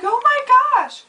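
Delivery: high, loud, slow mock gasp; drawn-out panicked oh my gosh; retold alarm. Facing the naked stranger, a panicked gasp. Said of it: "And I was like".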